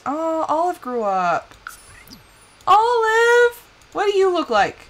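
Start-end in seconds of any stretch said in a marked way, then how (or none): 2.7-4.8 delighted call. Real, not acted. Player greeting grown-up Olive, delighted.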